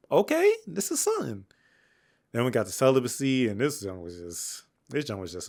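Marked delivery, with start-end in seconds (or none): 0.1-1.5 high, pleased surprise; impressed reaction. Retold or imitated. Reviewer retelling being impressed by the song, pitched high. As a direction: perk up, suddenly impressed. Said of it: "I was like".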